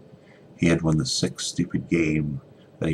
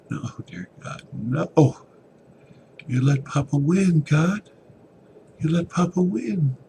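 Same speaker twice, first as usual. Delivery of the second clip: very high, loud, breaking cry; anguished cry; 12 semitones higher, 6 dB louder, slower than usual. Acted. Evan realises his father won, anguished cry to God. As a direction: wail it at God, betrayed.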